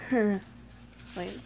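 ordinary talk

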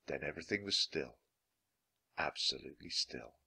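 soft, low storytelling; hushed narration. A narrator's reading. Narrator ends the storm, hushed and low. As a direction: drop low and quiet into stillness.

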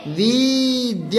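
विद्यालय drawn out very slowly, each syllable held; slow teacher's repeat of विद्यालय for pupils to follow. Shown as a demonstration. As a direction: stretch विद्यालय into long, separate syllables. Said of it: "क्या बोलते हैं?".

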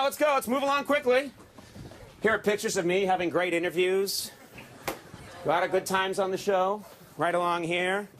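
usual speaking voice

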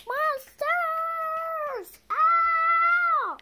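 playful monster shout. Pretend. Child shrieking about monsters in play. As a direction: scream about monsters for fun.

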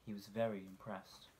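usual speaking voice

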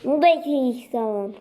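normal talking voice

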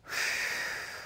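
long, soft audible exhale; deep breath out. Real, not acted. Trainer breathing out to cue a downward transition. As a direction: let the breath out slowly to settle the horse. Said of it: "There's my breath for my downward transition".

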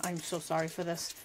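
ordinary talk

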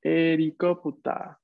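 helicóptero drawn out slowly as Japanese herikoputa; slow Japanese pronunciation. Shown as a demonstration. Teacher repeating the example word for the class, slow Japanese pronunciation. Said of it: "en la primera es helicóptero".

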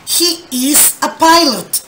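slow, clear model sentence. Shown as a demonstration. Teacher reading the next example aloud, slowly. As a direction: read it slowly for the class.

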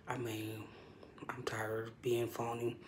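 slow hushed whisper, hiding. Real, not acted. Hiding in the bathroom from her boss, whispering. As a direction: keep it down so the boss can't hear.

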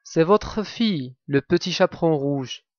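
usual speaking voice

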